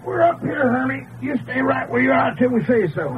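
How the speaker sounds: high call from above; shouting up to balcony. Acted. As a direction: call down to the boy, firm. Calling down from plane.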